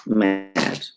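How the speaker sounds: ordinary talk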